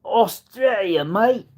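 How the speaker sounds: high, drawn-out Aussie voice; mock Australian accent. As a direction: say it as a playful Australian. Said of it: "they are from Australia".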